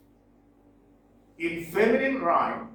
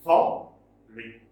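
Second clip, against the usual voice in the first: "falling" with weight on the first syllable, ending dropped; front-stressed "falling"; 5 semitones lower, 4 dB louder than usual. Shown as a demonstration. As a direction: lean on the start of "falling", then let it fall. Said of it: "For first syllable is stressed".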